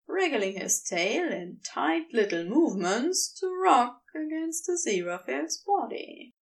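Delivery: plain reading voice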